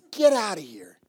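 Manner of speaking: loud get out; loud dismissal. Retold or imitated. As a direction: shout them off. First, loud take.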